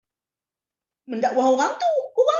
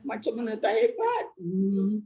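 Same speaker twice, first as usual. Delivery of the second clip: ordinary delivery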